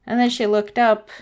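usual speaking voice

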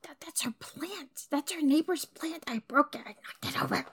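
guilty hushed whisper. Acted. Guilty confession after breaking plant.

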